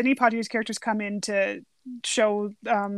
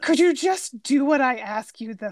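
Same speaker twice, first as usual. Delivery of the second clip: exasperated. Retold or imitated. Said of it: "Gillespie's responses".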